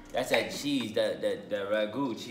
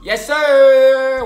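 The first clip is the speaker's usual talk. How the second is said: high, loud hype yell; excited shout; 13 semitones higher, 20 dB louder than usual. Real, not acted. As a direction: hype them up loudly. Cheering on a friend.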